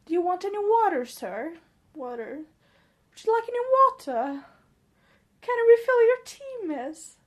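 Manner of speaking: high, polite British waitress voice; British customer-service accent. Shown as a demonstration. Offering customers water and tea in a British accent. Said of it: "I try to talk more British".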